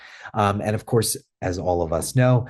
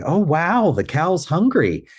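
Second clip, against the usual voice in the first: high, excited and slow, like playing with a toddler; fun playful voice; 9 semitones higher, slower than usual. Shown as a demonstration. Trainer demoing a fun play voice to new staff, excited and high. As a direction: sing it out with wide-eyed wonder. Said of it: "generate playful statements in a fun voice".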